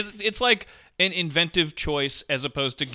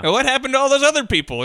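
mock rescuer question; 9 semitones higher, 5 dB louder than usual. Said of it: "finding the lifeboats at the end".

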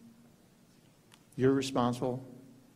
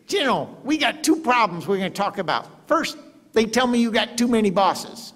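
higher, louder impersonation of the president; the president's voice; 9 semitones higher, 5 dB louder than usual. Retold or imitated. President telling the general he has too many bosses, higher put-on voice. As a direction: play the boss laying out problems.

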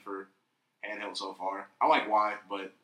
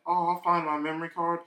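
higher, brightened retold self-talk; naively hopeful; 6 semitones higher, 3 dB louder than usual. Gamer recalling his lost memory card, mock hopeful. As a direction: quote your younger self, breezily hopeful. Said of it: "all hopeful".

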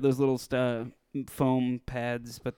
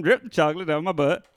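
bossy demand; 5 semitones higher, 5 dB louder than usual. Retold or imitated. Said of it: "I told him".

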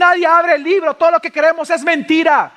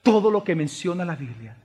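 low, soft voice with weighty pauses; hushed, solemn preaching; 10 semitones lower, 7 dB quieter than usual. Real, not acted. Preacher reveals to the church who the Lamb is, solemn hush. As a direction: quietly let the revelation settle.

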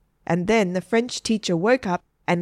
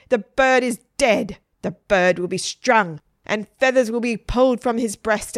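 raised, declaiming verse, sinking at the end; dramatic poem recital; 2 semitones higher, 4 dB louder than usual. A narrator's reading. Margaret writes a bitter poem to her teacher, declaimed. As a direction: declaim it as a dark curse.

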